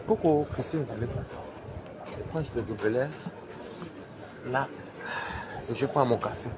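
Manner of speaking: low and soft so the office won't hear; quiet talk in the office corridor; hushed at the office. Real, not acted. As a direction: keep it down, coworkers nearby. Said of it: "je ne peux pas parler ici au bureau".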